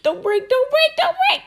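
high, loud begging that climbs; frantic pleading. Real, not acted. Player begging her box to hold, high and loud. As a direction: beg the box not to break.

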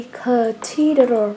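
"cathedral" drawn out slowly, a hard k sound up front; slow model word. Shown as a demonstration. Teacher models a word for her pupils, slow and clear. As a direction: spell out "cathedral" syllable by syllable with a clear k. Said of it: "chỉ có câu B là chúng ta đọc bằng âm cờ".

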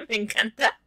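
laughing high and delighted; real giggle into words. Real, not acted. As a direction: laugh as you say you love it. Laughs at fabulous legs.

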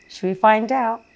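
ordinary delivery, nothing marked

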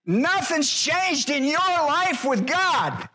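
slow, high, drawn-out preaching; preaching at full force. Acted. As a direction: stretch every word like a revival preacher.

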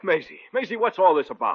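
alarmed. Acted. Panicking during arrest.

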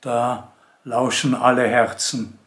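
measured poem reading with long pauses between lines; poetry recitation. Acted. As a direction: recite it solemnly, lifting and stressing key words, letting each verse stand. Liest Eichendorff-Gedichte vor. (Gedichtvortrag, getragen und mit langen Pausen)

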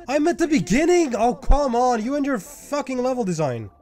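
high-pitched indignant complaint; exasperated outburst. Real, not acted. Gamer sent back to the beginning, exasperated outburst. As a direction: rail at the game in exasperation.

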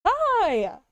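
high excited hello; cute anime streamer. Real, not acted. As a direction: squeal hi. High greeting.

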